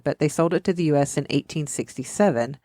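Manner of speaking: usual speaking voice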